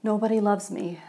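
ordinary talk, nothing marked

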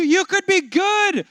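ordinary talk, nothing marked

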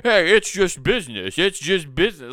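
hammed-up "it's just business" line; mock smooth talker. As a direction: play the slick pundit shrugging it off. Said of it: "they just gotta do the thing".

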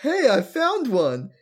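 high, loud, slow proud announcement; dim cheerful Agumon. Retold or imitated. Agumon before smashing his head into the wall, high loud proud. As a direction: announce it proudly like a happy dummy. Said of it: "Agumon goes".

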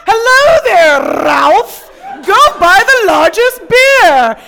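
slow, loud, high, overjoyed shouting; a reformed Scrooge calling from the window; joyful Scrooge. Retold or imitated. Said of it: "like, the end of A Christmas Carol".

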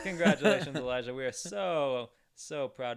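big, loud announcer congratulations; excited. Real, not acted. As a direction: celebrate the winner out loud. Announcing listener of the week.

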